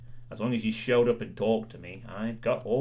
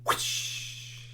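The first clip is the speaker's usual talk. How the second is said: breathy unvoiced whoosh like a whip swing; airy mock whip crack; 5 dB quieter than usual. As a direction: swish an imaginary whip at him. Urging chat on, mock whip.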